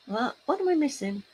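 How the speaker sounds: usual speaking voice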